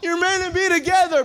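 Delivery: loud, high plea at the screen; screaming at the TV. Retold or imitated. Audience yelling at the romance couple, loud high shout. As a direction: beg the couple not to split. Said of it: "we're screaming at the T V saying".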